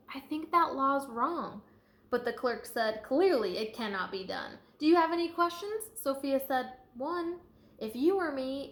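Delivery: lively, sing-song storybook read; reading to children. A narrator's reading. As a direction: read it out to a class with bounce. Reading picture book aloud.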